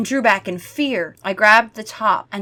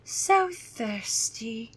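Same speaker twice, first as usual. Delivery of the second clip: thirsty vampire; 6 dB quieter, much slower than usual. Acted. Gwendolyn craving blood, slow soft moan. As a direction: draw out the hunger softly.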